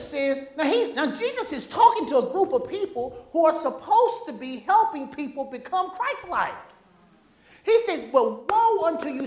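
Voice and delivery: loud, high, stretched pulpit delivery; preaching. Acted. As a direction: drive each woe home to the congregation. Preacher paraphrasing Jesus with force.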